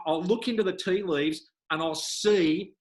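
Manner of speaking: ordinary talk, nothing marked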